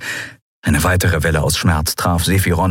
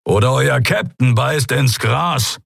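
threatening; 5 semitones higher, slower than usual. A narrator's reading. Threatening pirates with hostage.

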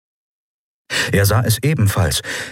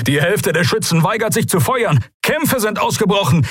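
panicked captain; 13 semitones higher than usual. A narrator's reading. Captain reports mutiny in battle.

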